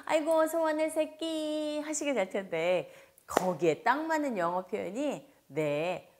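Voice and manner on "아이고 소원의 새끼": fond mom greeting. Pretend. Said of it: "반갑게 맞이해주셔야".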